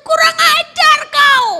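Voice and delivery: high, drawn-out furious acted shout; shocked, angry wife. Wife rebuking husband for abandoning Jesus, shocked high shout. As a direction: yell it slowly at full volume. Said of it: "istrinya terkejut".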